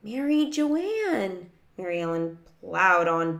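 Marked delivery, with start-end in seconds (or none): 0.0-1.5 high, slow, stretched-out name; drawn-out urging. A narrator's reading. Sister urges boyfriend to propose.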